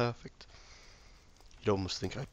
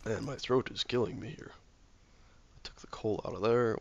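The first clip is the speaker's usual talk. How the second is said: ordinary talk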